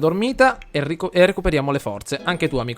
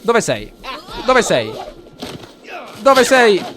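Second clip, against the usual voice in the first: from plain to higher, louder calling; building call; 7 semitones higher, 4 dB louder, slower than usual. Acted. Gamer's Sim calling for the neighbour to fight, calls getting louder. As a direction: call louder each time.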